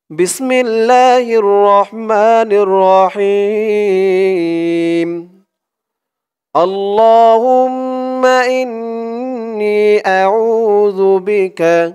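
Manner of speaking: slow, drawn-out chanted Arabic; melodic recitation. Acted. Teacher recites the dua slowly for viewers to repeat. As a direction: recite it slowly, stretching each vowel.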